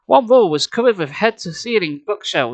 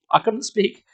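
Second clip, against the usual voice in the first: plain reading voice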